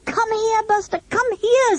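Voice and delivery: high call after a dog; yelling. A narrator's reading. Children yell at their dog mid-fight, high and urgent. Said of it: "All the children yelled at Buster".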